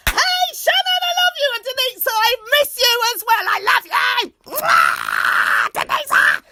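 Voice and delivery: very high, loud calls; shout-outs. Real, not acted. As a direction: shout love to friends. Shouting love to friends.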